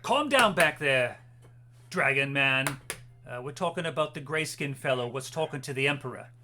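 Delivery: guard barking back. Acted. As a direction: put the prisoner in his place. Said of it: "looks and says".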